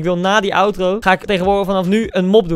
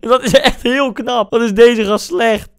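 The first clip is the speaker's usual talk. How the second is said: mocking; 5 semitones higher, 3 dB louder, slower than usual. Real, not acted. Mocking the opponent's bad play.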